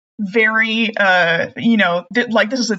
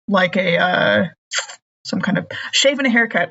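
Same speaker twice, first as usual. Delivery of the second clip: ordinary talk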